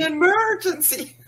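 high loud laughing exclaim; excited. Acted. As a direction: burst out with it, laughing. Repeated loudly with laugh.

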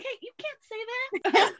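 high, drawn-out retold correction; acted teacher's gentle telling-off; mock teacherly reproach. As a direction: correct a pupil in a high, slow voice.

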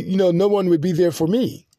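ordinary delivery

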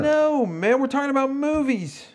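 high, loud exasperated protest. Real, not acted. Host correcting his co-host about the topic, real high-pitched exasperation. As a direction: set a clueless friend straight, voice jumping up.

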